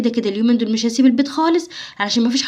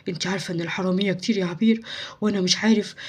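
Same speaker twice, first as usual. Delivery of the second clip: hushed warning; 4 semitones lower, 7 dB quieter than usual. Acted. Husband speaking low of secret.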